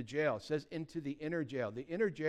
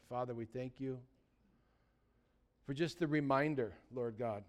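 prayer; 5 semitones lower, 4 dB quieter than usual. Acted. Closing prayer.

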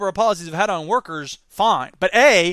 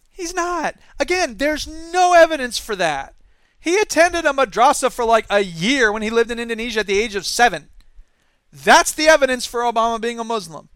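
exasperated; 3 semitones higher, 5 dB louder than usual. Real, not acted. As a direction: push it up in disbelief. Incredulous rant about Obama claim.

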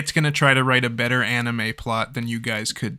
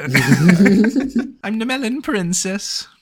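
high, slow princess put-on; hammed-up princess, laughing; melon princess; 7 semitones higher, 3 dB louder, much slower than usual. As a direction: draw it out high through laughter.

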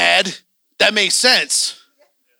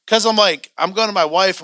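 normal talking voice

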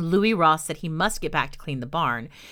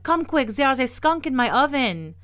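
put-on urgent, high, loud French-accented plea; calling out; 7 semitones higher, 5 dB louder than usual. A narrator's reading. Old woman begging a neighbor to come quick, calling out. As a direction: raise the alarm to the neighbor. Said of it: "she called out".

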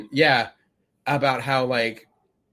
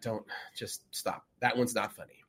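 hushed whisper; 5 semitones lower, 9 dB quieter than usual. Retold or imitated. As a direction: pull a friend aside and whisper it. Imagined friend reining in Cleese.

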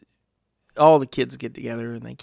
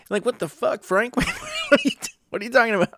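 high, incredulous, laughing protest; pitched-up bafflement with a laugh in it; mock exasperation; 10 semitones higher, faster than usual. Bettor to Frank after his ruling, mock bewildered.

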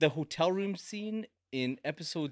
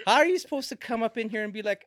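normal talking voice

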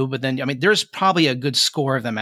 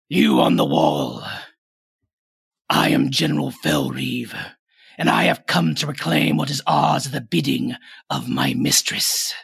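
undead general. Acted. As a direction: proclaim terms like a menacing warlord. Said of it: "he's calling out to you from down below".

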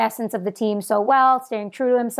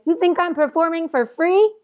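put-on Canadian accent; 7 semitones higher than usual. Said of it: "I tried to do a Canadian accent".

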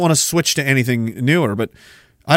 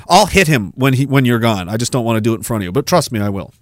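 normal talking voice

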